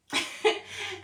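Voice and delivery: sudden high burst of laughter. Real, not acted. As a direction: laugh at yourself. Laughs at her own story.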